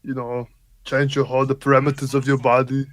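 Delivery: put-on thick Germanic action-hero accent; action-star governor. Co-host explaining his changed voice, action-star governor impression. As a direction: play the muscle-bound ex-governor, halting and deliberate. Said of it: "when I was governing from California".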